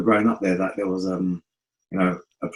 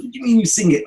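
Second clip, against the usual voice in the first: loud, high retold challenge; incredulous; 6 dB louder than usual. Dad learning his son sings, incredulous outburst. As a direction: question it in high-pitched disbelief.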